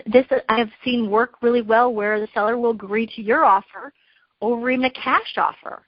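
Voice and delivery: normal talking voice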